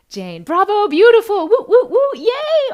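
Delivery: high, loud whooping cheer with laughter; excited cheer. Real, not acted. Host cheering a viewer's praise, excited whoops. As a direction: cheer them on, big and bright.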